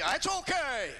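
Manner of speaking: high, blunt snapped question; grumpy mother-in-law. Retold or imitated. Mother-in-law answering son-in-law's call, high and curt. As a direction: answer the phone with a snap. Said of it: "I just wanted to see".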